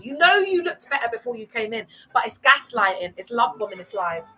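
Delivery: ordinary talk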